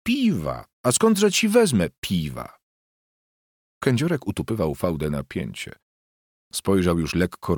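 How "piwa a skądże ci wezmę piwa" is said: high, mocking disbelief; derisive. A narrator's reading. Wife with no beer to give, high derision. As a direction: throw it back at him, pitched high. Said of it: "drwiąco zakręciła zadem".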